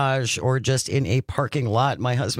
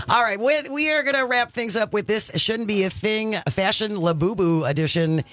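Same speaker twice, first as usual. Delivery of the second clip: upbeat radio host read; radio announcer; 7 semitones higher than usual. Acted. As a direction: sell the station break brightly. Radio host segment outro.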